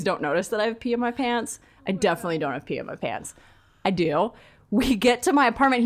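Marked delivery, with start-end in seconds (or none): none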